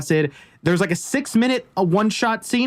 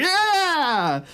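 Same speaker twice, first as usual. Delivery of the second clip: Nicolas Cage yell; 9 semitones higher than usual. Retold or imitated. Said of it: "Nicolas Cage wasn't really being Nicolas Cage".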